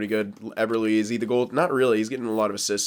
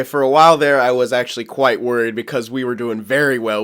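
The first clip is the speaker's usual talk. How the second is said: usual speaking voice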